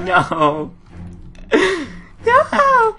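high, squealed refusal; panicked laughing no. Real, not acted. Player flees a scare in the game, panicked laughing no. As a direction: wail no through nervous laughter.

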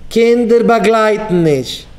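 called out. Retold or imitated. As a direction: proclaim it slowly to the crowd. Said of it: "man rief aus". (אויסגערופן, פאמעלעך און הויך)

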